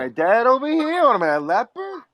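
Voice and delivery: high mock-offended New York Italian; an aggrieved Italian-American relative; New York Italian accent. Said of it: "Your Italian accent".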